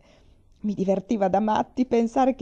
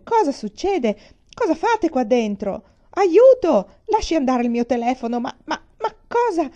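panicked director; 7 semitones higher, 7 dB louder than usual. Acted. Director overwhelmed by crowd.

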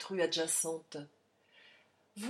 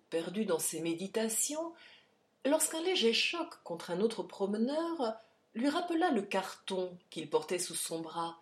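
ordinary delivery

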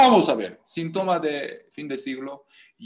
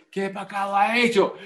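higher, louder questioning pastor voice; mock pastor question; mock pastor asking; 6 semitones higher, 4 dB louder than usual. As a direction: play the pastor quizzing the sinner. Pastor questioning sinner in skit.

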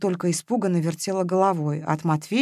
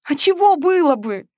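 high, louder retort; exasperated sigh; 9 semitones higher, 5 dB louder than usual. A narrator's reading. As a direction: toss the question back. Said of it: "опять вздохнула Ленка".

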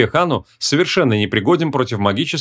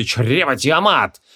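slow, drawn-out barked spell; barked incantation; much slower than usual. A narrator's reading. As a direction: bark out the spell word by word. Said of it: "одновременно рявкнули".

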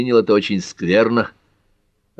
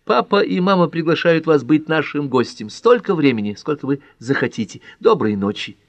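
put-on higher-pitched young woman's voice; Pat, lively invitation; 7 semitones higher than usual. A narrator's reading. Pat inviting Ellery to be a guest, lively high voice. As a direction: deliver the invitation pitched up, then settle on goodnight.

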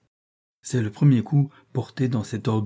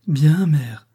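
a bright, louder cry, higher in pitch; exclaiming; 5 semitones higher, 5 dB louder than usual. A narrator's reading. Juana cries out to her mother, glad and louder. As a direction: call it out gladly to her mother. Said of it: "s'écrie Juana".

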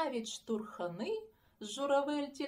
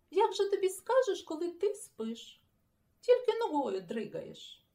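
reproach; 8 semitones higher, 7 dB louder than usual. Acted. Scolding the sleeper.

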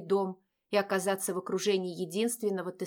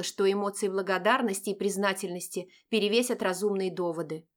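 ordinary talk

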